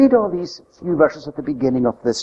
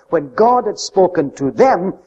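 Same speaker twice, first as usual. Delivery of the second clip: ordinary talk, nothing marked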